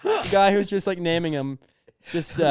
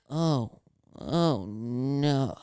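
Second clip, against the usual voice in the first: a lightly voiced, sleepy, suggestive moan; a touch of a whimpering sleep-talker; a faint, sleepy, naughty groan; dirty sleep talking; 5 semitones lower, 6 dB quieter, much slower than usual. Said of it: "dirty sleep talking".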